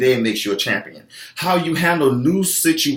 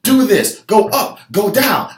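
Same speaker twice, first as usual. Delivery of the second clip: higher, punchy commands; trainer barking orders; 6 semitones higher, 3 dB louder than usual. Retold or imitated. Trainer directing a tired class, brisk commanding calls. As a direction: call out the moves like a drill coach. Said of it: "I'm like".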